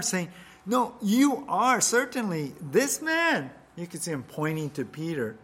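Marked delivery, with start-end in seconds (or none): 0.7-3.5 high, loud and slow, pinning him down; insistent accusation. Acted. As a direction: point at him and refuse to back down.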